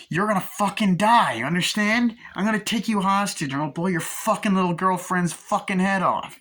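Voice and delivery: loud, high-pitched villain rant; furious threat. Retold or imitated. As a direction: act out a raging hostage-taker's threat. Acting out villain's hostage threat.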